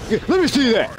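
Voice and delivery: high, grabby let me see; eager manager. Retold or imitated. Manager demanding bill.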